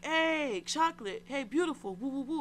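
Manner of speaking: high-pitched imitation of men hollering; playful retold catcalling; mock catcall holler. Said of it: "These cooks are hollering at me".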